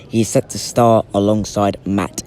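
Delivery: ordinary talk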